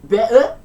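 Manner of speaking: loud Cockney BETTER with a glottal stop for the T; Cockney-style BETTER, middle T swallowed. Shown as a demonstration. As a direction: drop the T in "Better" into a catch in the throat. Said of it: "better is pronounced, this is gonna be awful".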